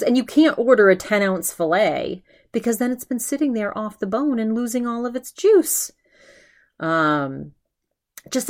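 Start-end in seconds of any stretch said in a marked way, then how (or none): 0.0-5.9 imitating an outraged rant; mock indignant, pitch up. Ben ranting about the bony steak, mock outrage. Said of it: "he's outraged at the price".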